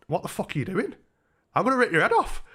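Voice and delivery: high-pitched mock threat; acted angry threat. Punched man going for the lad, retold indignant threat. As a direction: replay the threat, pitch climbing sharply.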